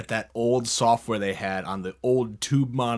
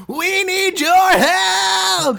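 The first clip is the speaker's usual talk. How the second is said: very high, loud, drawn-out cartoon plea; Bugs Bunny voice; 10 dB louder, much slower than usual. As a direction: squeal it like a desperate cartoon bunny. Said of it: "He said it in the Bugs Bunny".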